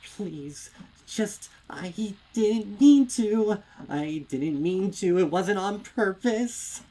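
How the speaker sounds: high, loud crying plea, breath catching; near-hysterical weeping apology; sobbing, desperate plea. A narrator's reading. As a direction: beg through sobs that she didn't mean it. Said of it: "she sobs".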